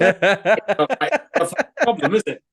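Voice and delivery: high, laughing through the words; real amused laughter. Real, not acted. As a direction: giggle out the punchline. Laughing at own joke.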